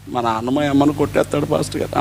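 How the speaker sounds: low, subdued confession; lightly voiced upset child, no real sobs; crying boy, imitated. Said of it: "ఒక పిల్లోడు ఏడుస్తున్నాడు".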